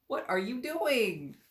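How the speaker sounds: high, loud, slow puzzled question; surprised question. Real, not acted. Reader reacting to something on screen, high and loud. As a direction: ask in real surprise.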